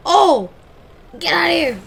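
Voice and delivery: loud, high yell; excited shout. Real, not acted. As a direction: shout it out in surprise. Crash excitement.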